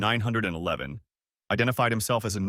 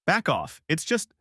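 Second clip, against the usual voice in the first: higher, sharp back off; warning snap; 6 semitones higher, slower than usual. Acted. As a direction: cut her off hard. Husband warns wife.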